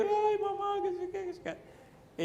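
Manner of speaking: very high imitated wail; high, drawn-out mock lament; mock wailing outcry. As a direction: shriek like mourners, hammed-up. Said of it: "ya el griterío".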